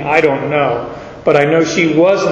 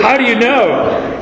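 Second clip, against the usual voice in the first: put-on eager young self; 6 semitones higher than usual. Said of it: "This is great news".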